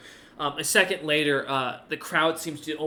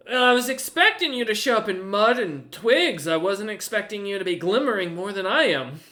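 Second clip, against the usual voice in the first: teasing giantess; 5 semitones higher, 6 dB louder than usual. Acted. Gertrude teases Cinder.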